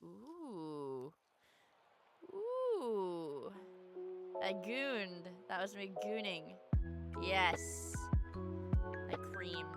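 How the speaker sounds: ordinary talk, nothing marked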